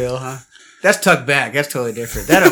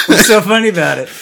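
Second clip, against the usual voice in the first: laughing; 6 semitones higher, 8 dB louder than usual. Real, not acted. Asks why they laugh.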